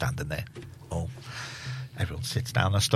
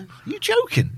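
shocked disbelief; slower than usual. Retold or imitated. Retold shocked reply.

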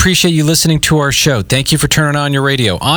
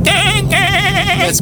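put-on dying baby goat bleat. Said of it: "Baby, not dying".

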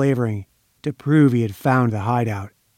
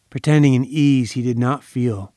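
ordinary delivery, nothing marked

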